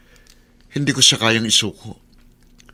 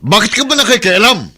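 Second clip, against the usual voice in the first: higher, louder annoyed retort; irritated snap; 6 semitones higher, 3 dB louder than usual. Acted. Husband scolding his mother-in-law for meddling, irritated and louder. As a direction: push back at her meddling.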